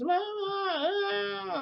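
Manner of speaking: very high, drawn-out mock wail; imitated bawling. Retelling the child's tantrum at goodbye, imitated bawling. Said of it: "and then just crying".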